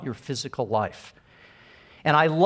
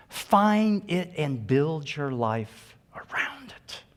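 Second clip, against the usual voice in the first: slow and deliberate, every word weighed; slow emphatic repeat; slower than usual. Shown as a demonstration. Speaker repeats the advice to the audience, slow and deliberate. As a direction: let it sink in slowly. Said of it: "repeats the advice slowly".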